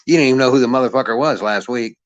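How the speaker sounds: ordinary delivery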